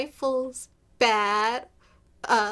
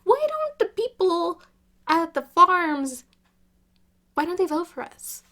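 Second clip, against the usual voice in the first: mock clueless Democrat; 6 semitones higher, 4 dB louder than usual. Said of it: "and then I go like".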